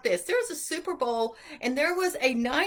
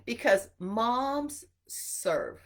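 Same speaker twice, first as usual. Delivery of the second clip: ordinary talk, nothing marked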